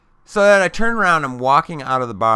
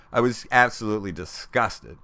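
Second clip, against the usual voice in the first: ordinary delivery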